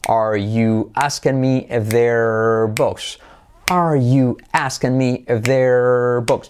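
slow, evenly beaten English phrase; stretched words linked in steady rhythm; rhythmic drill. Shown as a demonstration. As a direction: chant it slowly to a teaching beat. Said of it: "la hacemos con ritmo".

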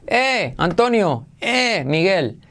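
"eh" alargado para saludar a un amigo; saludo efusivo. Acted. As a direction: saluda arrastrando el "eh". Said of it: "Como cuando saludas". (saludo lento, llamando a alguien)

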